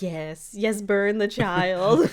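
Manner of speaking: imitating Stan's evil whisper-to-shout; villainous gloat. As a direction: savour it like a cartoon villain. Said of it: "the thing that he says".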